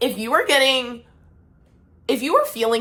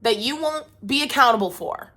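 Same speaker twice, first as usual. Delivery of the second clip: upset venting; 3 semitones higher than usual. Real, not acted. Upset rant at someone who hurt her.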